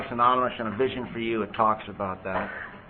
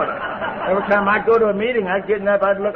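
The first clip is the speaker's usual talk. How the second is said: laughing; 10 semitones higher, 6 dB louder than usual. Real, not acted. Laughing while recalling avoiding meetings.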